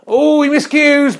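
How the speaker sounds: high, drawn-out cry of alarm at a miscue; excited commentary. Pretend. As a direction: react to the slip as it happens.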